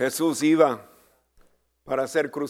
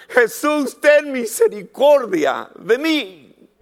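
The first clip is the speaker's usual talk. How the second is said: loud pleading cry; 8 semitones higher, 8 dB louder than usual. Retold or imitated. Bartimeo crying out to Jesus.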